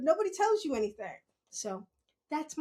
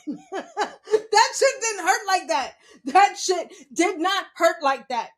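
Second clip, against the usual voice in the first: higher, slow, laughing hard; 5 semitones higher, 8 dB louder than usual. Real, not acted. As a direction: stretch it out laughing. Laughing about the fall.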